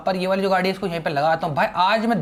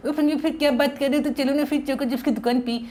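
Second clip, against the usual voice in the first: childish cartoon-boy voice, a little higher; Sinchan voice; 6 semitones higher than usual. Sinchan teasing his buddy Franky, in a childish cartoon voice. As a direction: play the cheeky cartoon kid.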